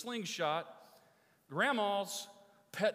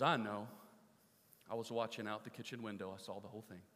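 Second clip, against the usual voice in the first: softer and lower, tender and calm; gentle grandma; 5 semitones lower, 6 dB quieter than usual. Acted. Grandma gently telling the boy she forgave him. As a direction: lean in and forgive him softly.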